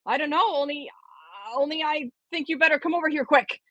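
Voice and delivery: put-on high, hesitant boy voice; unsure, nervous and urgent; anxious boy voice. Nervous Johnny calling professor.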